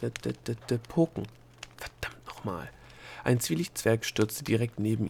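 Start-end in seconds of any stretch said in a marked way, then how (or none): 0.0-1.3 stuttered word search, held back in volume; desperate thinking. A narrator's reading. Delia tries the word in her head, desperately. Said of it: "dachte sie verzweifelt". 1.8-2.7 low, hushed curse under the breath; desperate curse. A narrator's reading. Delia curses her failing memory, desperate and hushed. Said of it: "dachte sie verzweifelt".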